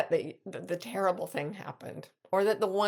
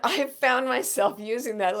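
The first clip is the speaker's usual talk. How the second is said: usual speaking voice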